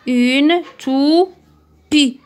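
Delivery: "Une toupie" stretched very slowly and loud, tou-pie; drawn-out syllables. Shown as a demonstration. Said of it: "C'est la pi".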